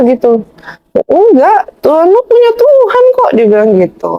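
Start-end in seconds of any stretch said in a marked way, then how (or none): 1.1-3.3 high-pitched retold objection; contradicting retort. Guest imitates his debate opponent arguing about God, contradicting.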